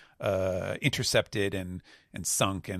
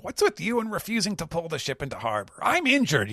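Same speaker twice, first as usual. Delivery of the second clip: fast, higher whiny complaint; Pizarro voice; 9 semitones higher, faster than usual. Acted. Injured Pizarro complains to Devon, fast whiny pitch. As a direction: grumble fast, then squeal about being hurt. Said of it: "And he's like".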